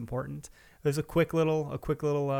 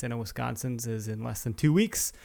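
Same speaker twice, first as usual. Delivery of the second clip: usual speaking voice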